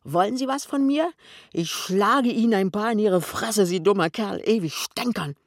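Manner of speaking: loud, high-pitched outburst; worked-up furious challenge; angry threat. A narrator's reading. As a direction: threaten him in rising rage. Pinneberg threatens to hit Kessler.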